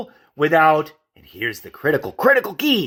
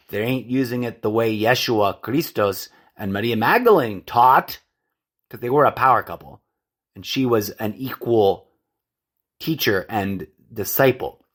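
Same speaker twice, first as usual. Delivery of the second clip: usual speaking voice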